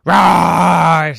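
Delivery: loud playful rawr; dinosaur roar. Acted. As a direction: roar like a T-Rex. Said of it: "said the T-Rexes".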